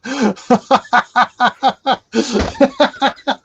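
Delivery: big real laugh from the belly; long hearty laugh. Real, not acted. Host laughing at bacon joke.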